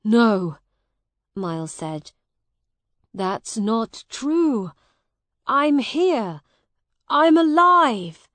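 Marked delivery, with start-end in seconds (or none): 0.0-0.6 loud, startled denial; shocked protest. A narrator's reading. Miles hears he was reported dead, shocked protest. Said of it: "Miles was very surprised". 3.1-8.3 loud protest climbing higher and higher; shocked protest. A narrator's reading. Miles protests to Hugh that he's alive, shocked. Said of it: "Miles was very surprised".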